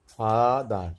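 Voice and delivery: ফাদার with an open ah for the e, loud; teacher models ফাদার with an ah ending; ah sound. Shown as a demonstration. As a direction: demo of ফাদার with ah vowel. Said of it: "ইয়ের উচ্চারণটা আয়ের মতো আসছে".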